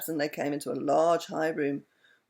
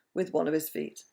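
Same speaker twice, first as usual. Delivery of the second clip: usual speaking voice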